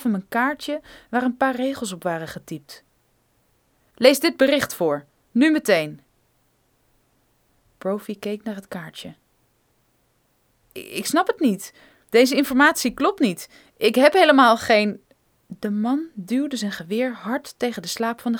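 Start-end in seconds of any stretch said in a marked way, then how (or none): 3.9-6.0 curt order. Acted. Armed man demanding. 10.8-15.0 stammering protest. Acted. Frightened geologist protesting.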